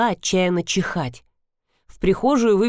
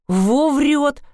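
higher, gleefully impressed outburst; admiring exclamation; 7 semitones higher than usual. A narrator's reading. Nina reacts to a tall tale, delighted. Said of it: "восхитилась Нина".

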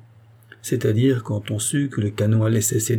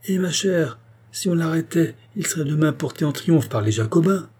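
higher-pitched, insistent reply; exasperated explaining; 6 semitones higher than usual. A narrator's reading. Queen answers Madame Campan's objection, exasperated. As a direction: make her see sense, pitched up.

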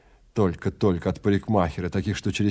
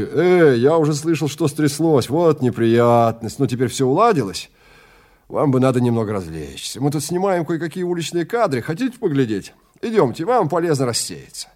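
higher and louder, a bustling movie man; loud, higher-pitched hearty showman; brash Melton voice; 5 semitones higher, 5 dB louder than usual. Acted. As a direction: boom out a cheery invitation, pitched up. Film man Melton hurries over.

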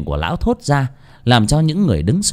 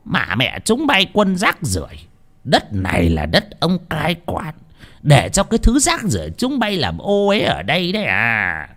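higher, scornful abuse of beggars; a bully official lording it over the poor; sneering tyrant; arrogant chief; 6 semitones higher than usual. Acted. Village chief berates beggars.

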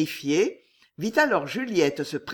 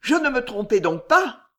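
frightened; 5 semitones higher, 6 dB louder than usual. A narrator's reading. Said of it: "au comble de l'épouvante".

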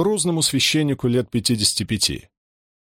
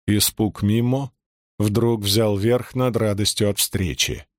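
ordinary delivery

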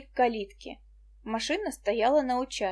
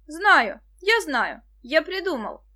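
loud, higher burst of inspiration; excited cry; 6 semitones higher, 8 dB louder, slower than usual. A narrator's reading. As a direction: cry out as the idea strikes. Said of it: "закричал вдруг Денис". (радостный выкрик)

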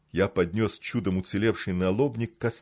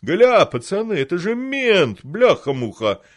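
gurgling; 9 semitones higher, 8 dB louder than usual. Acted. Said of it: "пробулькал он".

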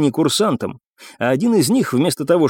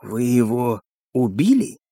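slow, slightly softer probing question; insinuating; 3 dB quieter, slower than usual. A narrator's reading. Captain questions the cadets about the missing man, insinuating. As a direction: ease the question out silkily. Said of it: "голос стал более вкрадчивым".

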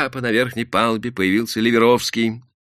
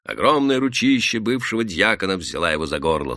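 plain reading voice

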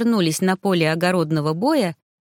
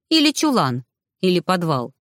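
ordinary delivery

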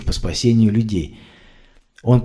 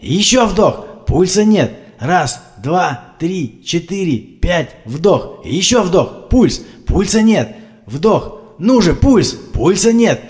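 frantic CPR; 6 semitones higher, much slower than usual. A narrator's reading. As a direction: keep pumping, voice climbing in despair. Frantic CPR counting and pulse.

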